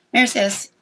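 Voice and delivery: high calling voice. Real, not acted. Calling the dog over.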